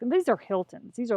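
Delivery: normal talking voice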